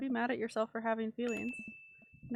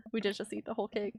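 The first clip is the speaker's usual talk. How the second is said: ordinary talk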